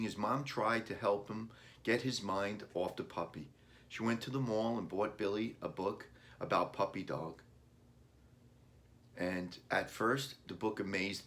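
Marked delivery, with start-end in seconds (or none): none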